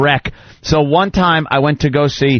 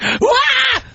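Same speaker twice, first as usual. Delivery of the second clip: mock kid scream. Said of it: "I was like".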